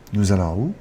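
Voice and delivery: casual question lifting on "où"; informal, "où" gliding up; rising intonation. Shown as a demonstration. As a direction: let "où" rise at the end. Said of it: "simply just rising the intonation".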